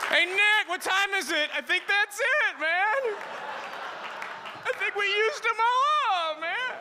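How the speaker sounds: very high, drawn-out character voice; talking microwave voice. Acted. Microwave clock talking to stoned Nick, high silly voice. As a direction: squeal it high, stretching the last words.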